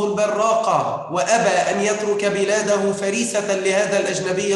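loud, declaiming passage reading; formal reading. Acted. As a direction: read the heroic passage aloud to the class. Teacher reads a passage aloud.

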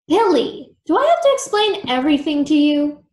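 exasperated and emphatic. A narrator's reading. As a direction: scold like someone fed up with explaining. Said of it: "Creepella said with a sigh".

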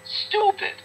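contemptuous squeal; scornful outburst. Real, not acted. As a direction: spit it out in disgust.

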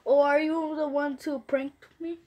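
ordinary talk

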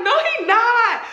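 high, slow insistent no; friend denying. Retold or imitated. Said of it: "she's like".

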